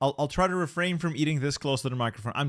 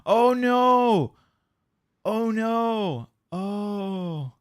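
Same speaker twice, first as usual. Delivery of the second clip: loud, high dismayed cry; 9 semitones higher, 5 dB louder, much slower than usual. Real, not acted. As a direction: cry out in sympathy. Reacting to fleet loss story.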